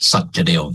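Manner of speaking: "สัจเดล" said sat-ja-del, not sak-dep; owner's pronunciation. Shown as a demonstration. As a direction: say "สัจเดล" with a j sound and an l-like ending. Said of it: "เจ้าของชื่อเขาออกเสียงว่า".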